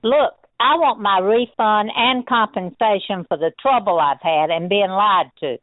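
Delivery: normal talking voice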